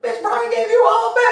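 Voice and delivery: put-on high, laughing girlfriend voice; laughing mock of the girl's friends; mocking homegirl. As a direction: squeal it like her teasing friend. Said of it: "all her homegirls are like".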